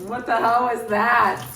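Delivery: loud, startled outburst. Real, not acted. Startled by a kitchen noise, she blurts out loud. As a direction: jolt up at the noise.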